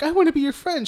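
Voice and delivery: high, friendly clown voice; Pennywise. Retold or imitated. Host retells the clown approaching the girl, high mock-friendly voice. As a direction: imitate a clown asking to be friends, pitched well up. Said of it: "fucking Pennywise is like".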